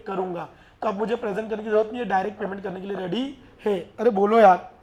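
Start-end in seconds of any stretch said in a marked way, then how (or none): none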